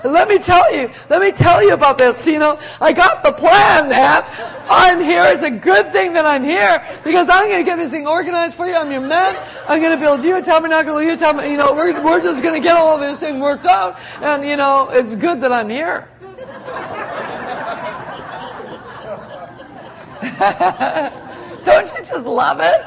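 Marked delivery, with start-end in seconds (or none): 0.0-14.0 loud, high, pushy boast laughing through; cocky Peter. Retold or imitated. Boastful Peter taking charge. 16.1-21.6 real delighted laughter; long laugh. Real, not acted. Preacher laughs at story.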